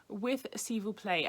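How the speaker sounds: ordinary talk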